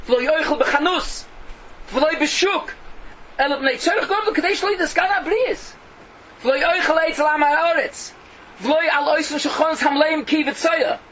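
high, loud preaching recitation; forceful declamation. Real, not acted. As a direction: proclaim each clause of the ruling. Declaims Rambam's rulings at full force.

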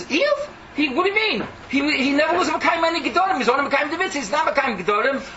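high, incredulous, quick retort; scoffing accuser angel. Acted. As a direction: scoff at the praise.

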